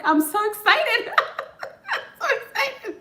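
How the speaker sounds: high-pitched, bursting into giggles; giddy excitement. Retold or imitated. Medium voicing an overjoyed aunt for her niece, giddy and laughing. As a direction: relay a spirit's excitement, laughing through it. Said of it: "she's, like, so excited".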